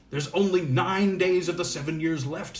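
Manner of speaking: expostulating. A narrator's reading. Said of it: "in a tone of expostulation".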